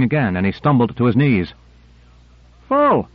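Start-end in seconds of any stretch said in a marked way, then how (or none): 2.7-3.1 high sharp cry of full; cried out. A narrator's reading. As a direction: shout it up, much higher. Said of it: "cried Owens".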